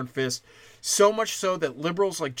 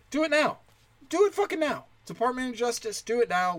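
furious, much higher ranting demand; pissed-off demand; 10 semitones higher than usual. Real, not acted. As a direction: hurl it at the Justice Department in real rage. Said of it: "my relative emotion is pissed off".

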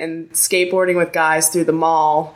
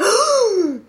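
high, exaggerated mock gasp; imitated gasp of horror; shocked gasp. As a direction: suck in air as if scandalised. Imitates shocked listeners gasping.

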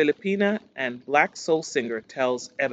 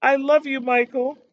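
high, loud gushing imitation of a mother; mock tearful cry; 11 semitones higher, 4 dB louder than usual. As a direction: imitate an overcome fan calling out. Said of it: "Her mom was crying".

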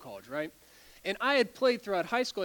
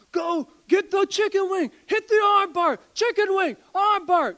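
much higher and louder barked commands from the sideline; coach's yell; 12 semitones higher, 9 dB louder than usual. Retold or imitated. Wrestling coach yelling instructions to his wrestler, a full shout. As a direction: yell the moves at your wrestler. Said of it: "So I hear him yell".

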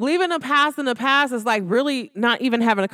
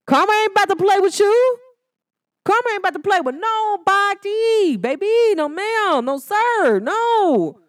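higher, louder sassy warning to everyone; 8 semitones higher, 4 dB louder than usual. Acted. Warning listeners about karma, loud sassy attitude. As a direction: wag a finger, karma ain't playing.

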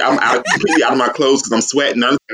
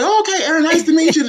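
friendly greeting; faster than usual. Retold or imitated. Voicing Renee greeting.

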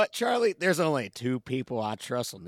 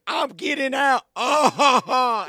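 high, hammed-up fake cackle after a smug line; mock the forced jail-cell laugh, pitched way up; Nicolas Cage's fake laugh; 12 semitones higher, 6 dB louder, slower than usual. Imitating the film's jail-cell laugh for the hosts, a high hammed-up fake cackle. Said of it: "the fake laugh that he gives".

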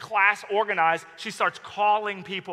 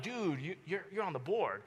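hushed warning; 7 dB quieter than usual. Retold or imitated. Friend quietly warns him in class.

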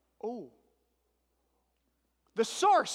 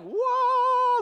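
long, high, loud whoa; loud, sustained exclamation of wonder; awed whoa; 13 semitones higher, 8 dB louder than usual. Acted. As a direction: draw out a big amazed whoa. Acting out the doors swinging open.

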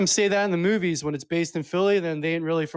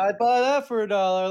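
catchphrase; 4 semitones higher than usual. Shown as a demonstration. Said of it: "Let me hear you say it".

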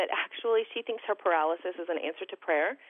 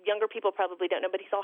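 ordinary talk

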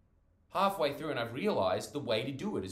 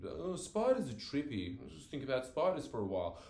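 higher, dreamy musing; dreamy friend; 5 semitones higher than usual. Retold or imitated. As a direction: drift off in a mock dreamy voice. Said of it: "everything's just a dream to him".

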